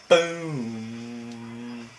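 long boom held level after a drop; slow, droning boom; imitated explosion. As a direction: draw out boom on a sustained note.

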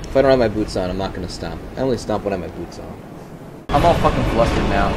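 ordinary delivery

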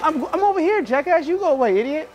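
mocking retort. Real, not acted. Prankster told to go away by a shopper, mocking. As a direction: throw it back in a high, mocking pitch.

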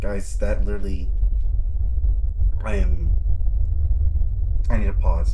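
loud, broken-off exclamations; spooked gamer needing a breather; real shaken alarm. Real, not acted. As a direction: speak loudly, too rattled to finish. Shaken player after jump scare.

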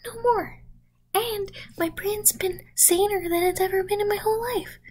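high, proud and gleeful; smug triumph. Acted. Boasting about the cure. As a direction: brag like it's fixed.

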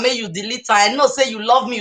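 ordinary delivery

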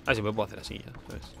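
ordinary talk

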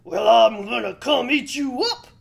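loud threatening troll. Acted. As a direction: roar it like a hungry troll. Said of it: "said the troll".

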